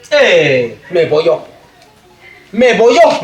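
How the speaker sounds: ordinary delivery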